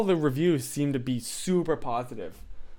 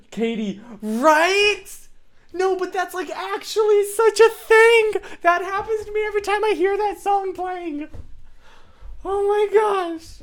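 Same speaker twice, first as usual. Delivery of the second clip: much higher, squealing excitement; high excited squeal; 5 dB louder than usual. Real, not acted. Streamer answering Katie in chat, squealing high with excitement. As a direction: squeal with delight.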